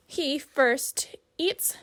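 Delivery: plain reading voice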